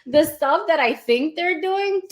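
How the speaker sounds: high laughing rush of excitement; giggly and thrilled, voice climbing; excited laughing disbelief. Retold or imitated. Excited about company's product.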